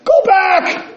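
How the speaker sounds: high desperate screaming warning; drowning boy's piercing cry of go back; screamed warning. Retold or imitated. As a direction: scream it to stop your friend. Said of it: "and he screamed again".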